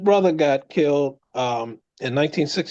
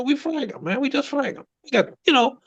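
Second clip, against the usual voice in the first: higher voice, matter-of-fact; lightly voiced old soldier, higher pitched; veteran's blunt quote; 11 semitones higher than usual. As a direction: tell it like it's obvious. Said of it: "matter of fact, he said".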